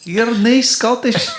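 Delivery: high, slow Scottish "You're nae Scottish"; Scottish accent, high and slow; Scottish comeback. Shown as a demonstration. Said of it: "What are you gonna do about that".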